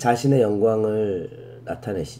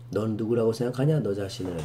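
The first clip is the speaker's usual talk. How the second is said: ordinary talk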